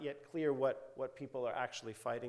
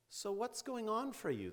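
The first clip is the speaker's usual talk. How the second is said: lightly voiced therapist's probing question, higher pitch; gently pressing; 10 semitones higher than usual. Therapist asking a vague client what is going on, gently pressing. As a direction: press a little, imitating a therapist. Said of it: "the therapist presses".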